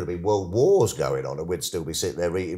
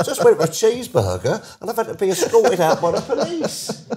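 much higher and louder, laughing through it; laughing disbelief; 6 dB louder than usual. Retold or imitated. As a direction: tell the wife the absurd story, laughing. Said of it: "I said".

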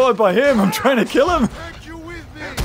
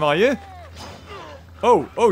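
ordinary talk

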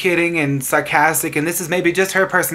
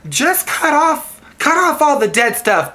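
high, drawn-out mimic of a client; mock a client asking for a trim, pitched way up; mock client whine; 9 semitones higher, slower than usual. Hairdresser mocking a client's request in a high voice. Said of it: "people say".

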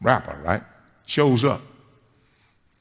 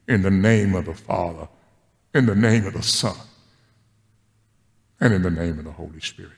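benediction; 6 semitones lower than usual. Acted. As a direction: send them out with a blessing. Closing benediction.

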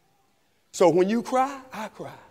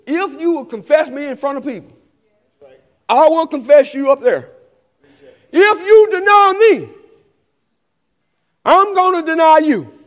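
voicing Jesus's warning; 6 semitones higher, 5 dB louder than usual. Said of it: "here's what Jesus said".

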